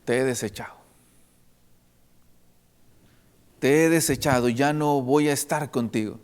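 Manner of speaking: low, hushed words of rejection; quiet, grave dismissal; pained rejection. Acted. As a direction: quote God's painful verdict softly, with long pauses. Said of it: "palabras, hermanos, más dolorosas".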